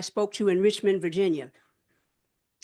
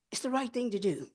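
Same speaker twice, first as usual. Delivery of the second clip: softer, settling down on "right"; quiet repeat; 4 semitones higher, 6 dB quieter than usual. Real, not acted. Senator echoing her own plea to the audience, softer. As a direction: drop the voice and let "It's the right thing to do" land gently.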